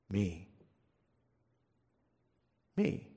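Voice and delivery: soft, barely-voiced "Me"; hushed answer. Jesus quietly names himself to the disciples. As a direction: let it fall almost silent.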